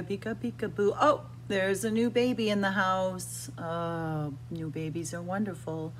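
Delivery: usual speaking voice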